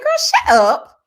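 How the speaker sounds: loud, high-pitched retold snap; mock-outraged high yelp; exasperated outburst. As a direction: burst out to shush her, loudly. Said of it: "I said".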